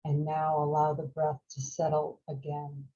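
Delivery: calm, unhurried meditation voice with long pauses; guided meditation. Real, not acted. Teacher guiding a group to follow the breath, calm meditation voice. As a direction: guide them gently through each breath, leaving space.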